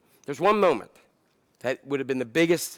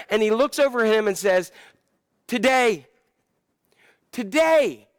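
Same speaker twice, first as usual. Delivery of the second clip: rising preaching; 6 semitones higher than usual. Acted. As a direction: preach it to the climax. Preaching climax builds.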